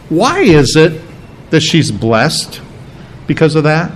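usual speaking voice

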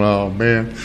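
low, soft retold groan of "oh, man"; mock dismay. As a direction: drop it low and quiet, as if caught out. Retelling his own dismayed reaction.

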